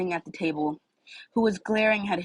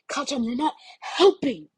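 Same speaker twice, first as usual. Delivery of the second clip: higher, slow, exasperated; plead, worn out; pleading Izuku; 7 semitones higher than usual. Acted.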